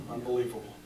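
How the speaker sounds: usual speaking voice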